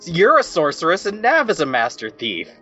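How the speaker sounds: ordinary talk, nothing marked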